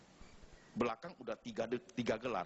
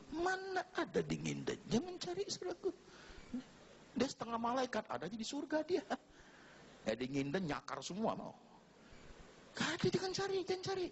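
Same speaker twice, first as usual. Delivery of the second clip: much higher, playful warning; high joking warning; 13 semitones higher than usual. Real, not acted. Preacher teasing the single men in the congregation, much higher. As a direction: tease them not to bother looking.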